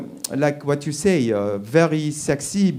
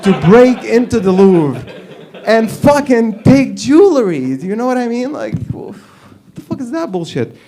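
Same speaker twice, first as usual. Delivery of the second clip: outraged; 8 semitones higher, 6 dB louder than usual. Real, not acted. Continuing outraged rant.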